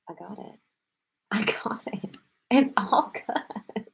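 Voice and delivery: soft, near-whispered easy reassurance; calm. Shown as a demonstration. Coach showing followers the grounded way of coping, soft and calm. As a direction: let it go, quietly unbothered. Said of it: "like a".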